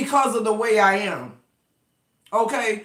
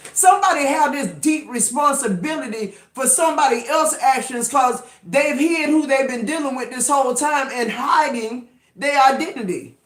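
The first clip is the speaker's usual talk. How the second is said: usual speaking voice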